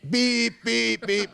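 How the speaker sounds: high, loud mock horn honks; imitated car horn. Retelling the brakeless car, he mimics honking drivers, high and loud. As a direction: toot like angry traffic horns.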